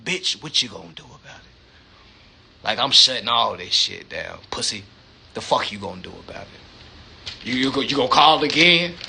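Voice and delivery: a lightly played collector mocking a debtor; mock menacing creditor. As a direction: sneer it low like a debt collector daring them. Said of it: "And they said".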